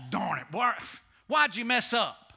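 ordinary talk

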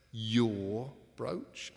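"Your" hit loud and drawn out, then a questioning "brooch?"; indignant stress on YOUR. Acted. Tom disputes Ebenezer's claim, indignant, with a loud stretched "Your".

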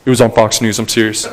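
low, hushed rumour voice; a lightly voiced gossip; gossiping conspiracy believer. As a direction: mimic someone passing on a rumour, low and quiet. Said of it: "Let me use something old".

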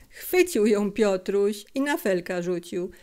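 usual speaking voice

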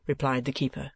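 plain reading voice

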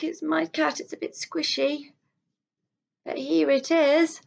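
lively storybook reading; reading to children. A narrator's reading. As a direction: read it aloud to little ones, full of wonder. Reads a picture book to children.

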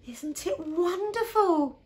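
high, slow, drawn-out admiration; delighted wonder. Acted. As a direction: marvel at it in a high voice. Mum admires the tidy house.

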